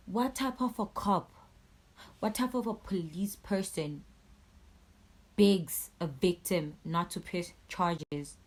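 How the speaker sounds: slow, emphatic outrage; indignant rant. Real, not acted. Victim condemning police who begged her, indignant rant. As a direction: punch each word loudly, drawing it out in disbelief.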